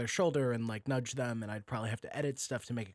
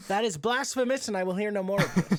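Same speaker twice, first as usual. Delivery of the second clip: high, loud mock outrage; hammed-up indignation at the idea; mock indignant decree; 6 semitones higher, 6 dB louder than usual. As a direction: play it like a scandalised tyrant. Mock outrage at writing help.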